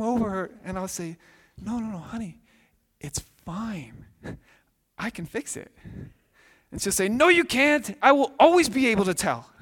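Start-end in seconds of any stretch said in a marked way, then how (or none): 1.6-5.7 soothing dad. Retold or imitated. Father soothing upset daughter. 7.1-9.5 put-on high, loud insistent kid voice; stubborn child. Daughter refuses comfort.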